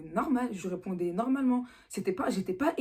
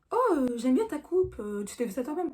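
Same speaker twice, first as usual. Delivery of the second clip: principal's friendly voice; 5 semitones higher than usual. Retold or imitated. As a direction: ease off, compliment the hairdo. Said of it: "il me fait".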